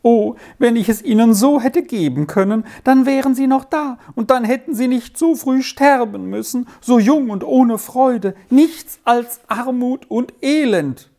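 put-on keen for the lost children, voice climbing; wailing. A narrator's reading. Grieving mother wails about her children to the visitors.

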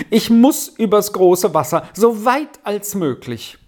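urgent, emphatic son; insistent. A narrator's reading. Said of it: "versicherte Sami".

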